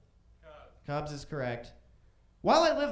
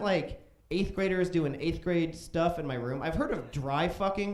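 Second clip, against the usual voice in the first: usual speaking voice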